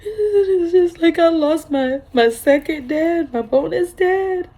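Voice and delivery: high, tearful voice breaking over the loss; real tears on every word; crying. Real, not acted. Grieving stepfather, crying again.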